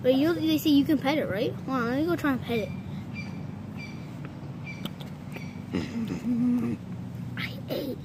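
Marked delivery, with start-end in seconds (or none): 2.7-7.3 sneaky whispered laugh. Acted. Sneaky laugh after stealing chip. 7.4-8.0 sneaky whispered aside; hushed, guilty whisper. Acted. Whispering aside after stealing chip.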